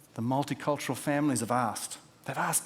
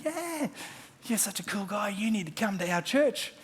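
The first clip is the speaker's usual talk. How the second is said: eager invitation; 8 semitones higher than usual. Retold or imitated. Retelling his eager invitation.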